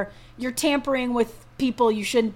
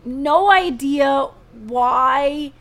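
slow, drawn-out disbelief; baffled; 3 semitones higher, 5 dB louder, much slower than usual. Real, not acted. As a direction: stretch out the confusion. Baffled about McGregor acting.

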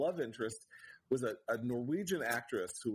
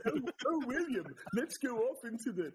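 higher-pitched, affected theatrical villain; Doctor Smith; 7 semitones higher than usual. Retold or imitated. Lost in Space villain luring young William, florid affected voice. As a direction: coax in a hammed-up florid voice. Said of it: "which is Doctor Smith".

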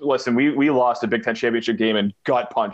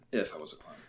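softer clown aside; 7 semitones lower, 16 dB quieter, faster than usual. Acted. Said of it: "repeats line, much softer".

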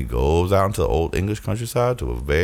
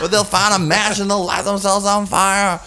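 urgent comic warning; 10 semitones higher than usual. Acted.

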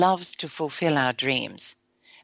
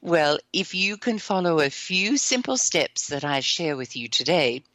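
usual speaking voice

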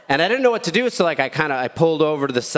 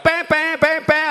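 mock car horns; 14 semitones higher than usual. Traffic honking imitated.

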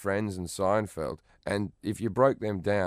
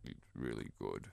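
hushed awed teachers; 6 semitones lower, 5 dB quieter than usual. Retold or imitated. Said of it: "they go".

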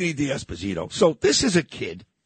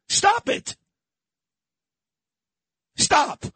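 sharp, much higher "Stop it" cry; angry stop; 14 semitones higher, much slower than usual. Real, not acted. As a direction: bark it out to make them quit. Yelling at Republicans on air.

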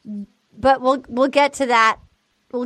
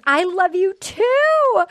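slow, higher, dreamy imitation of her; mimicking her reply; 5 semitones higher, much slower than usual. Retelling the bachelorette's love declaration, in a slow swooning mimic. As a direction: draw it out like a smitten woman. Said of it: "she goes".

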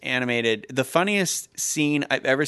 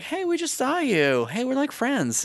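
much higher, slow, gushing fangirl; starstruck fan voice; 12 semitones higher than usual. Retold or imitated. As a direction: call out to the star like an awestruck fan.

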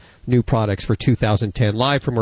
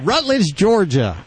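playful echo, first word pitched high; 7 semitones higher, much slower than usual. Retold or imitated. Host echoes Southern caller's home town, playful echo. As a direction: echo the town name with a high, falling lilt.

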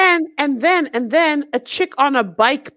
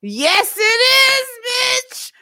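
hyped yes; 11 semitones higher, slower than usual. Retold or imitated. Hyped answer to own question.

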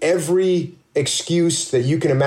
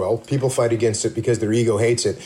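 usual speaking voice

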